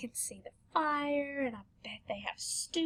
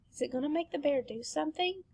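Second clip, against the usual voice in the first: plain reading voice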